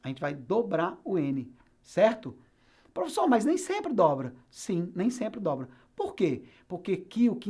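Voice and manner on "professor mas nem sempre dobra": higher, questioning student voice; student objection. Acted. Teacher voices a student doubting the rule, questioning. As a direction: pipe up as a doubting pupil.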